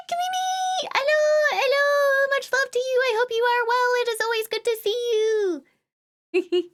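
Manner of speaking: very high, excited squeal; high-pitched, stretched-out, thrilled hello; delighted greeting. Real, not acted. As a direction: gush a sing-out welcome far up in pitch. Greeting a viewer who arrives.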